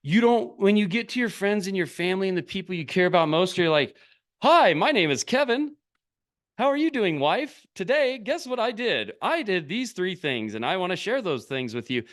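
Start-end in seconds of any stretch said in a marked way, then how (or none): 4.4-12.0 mocking a stilted, much higher formal voice; stiff formal self-introduction. Mocking how people talk to their wife on social media, stiff and high-pitched. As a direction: pitch it up like a wooden presenter introducing himself. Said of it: "do they talk to you like that?".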